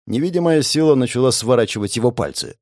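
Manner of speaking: ordinary delivery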